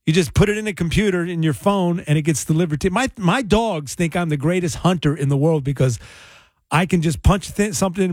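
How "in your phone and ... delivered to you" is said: ordinary talk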